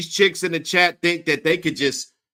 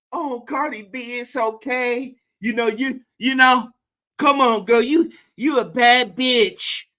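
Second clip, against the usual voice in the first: higher, mocking impression of a fan; high-pitched mocking fan, taunting; 7 semitones higher than usual. Mocking the fans who cheer her on. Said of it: "All of this bullshit".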